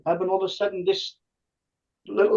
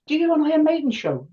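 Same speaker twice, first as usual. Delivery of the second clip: insisting; 10 semitones higher than usual. Retold or imitated. Wife insisting, retold.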